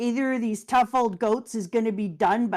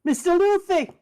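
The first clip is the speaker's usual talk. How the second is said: frantic Bartolomeo voice; 10 semitones higher, 4 dB louder than usual. Acted. Voicing Bartolomeo upset.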